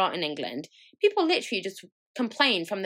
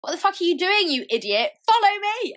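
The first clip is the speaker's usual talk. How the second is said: high, loud exasperated snap; mock exasperated yell; 13 semitones higher, 7 dB louder than usual. Telling off the man in the queue, high loud exasperated. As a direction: scold him like an idiot, then lead.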